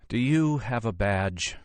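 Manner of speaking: tired, resigned question; a touch of weariness; weary. A narrator's reading. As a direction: ask it worn out. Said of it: "she asked wearily".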